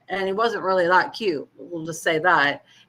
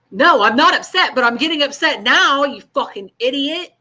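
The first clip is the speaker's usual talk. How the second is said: higher and louder, losing patience; retold outburst; 6 semitones higher, 6 dB louder than usual. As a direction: snap at him, fed up. Retelling a fight with ex.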